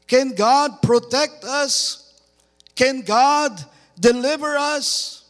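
high, loud pulpit delivery; forceful preaching. Real, not acted. Preacher challenges the congregation on doubting God, forceful preaching. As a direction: drive each question home to the congregation.

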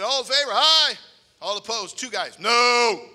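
loud, rising call of the vote, then a long, slow no; booming vote call. Retold or imitated. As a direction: bellow the vote across the church. Preacher calls the church vote.

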